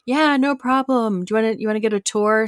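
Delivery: overly cheery host, forced; imitating Mark. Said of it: "Mark's like".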